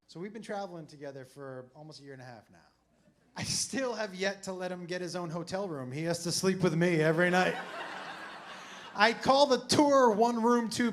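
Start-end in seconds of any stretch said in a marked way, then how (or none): none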